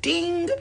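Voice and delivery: high, ringing mock bell sound; imitated ding. Showing a hit in the head, imitated ding. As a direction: sing out a high 'ding'.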